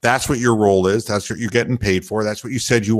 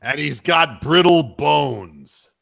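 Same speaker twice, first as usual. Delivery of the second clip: lightly voiced grumbling old announcer, slower; mock despair of a gruff commentator, slow and drawn out; Tommy Heinsohn impression; 3 semitones higher, slower than usual. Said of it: "I wonder if Tommy would have said".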